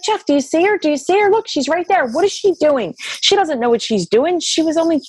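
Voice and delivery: high, insistent, repeating the question; begging him to look and see her too; urgent pleading. Retold or imitated. To her husband Jeff about Laura, high urgent pleading.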